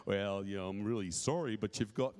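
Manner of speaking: mock sorry doctor tone; imitating doctor. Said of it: "What does a doctor say".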